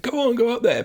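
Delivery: high, urging go on go up there; egging them on. Retold or imitated. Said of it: "and he's like".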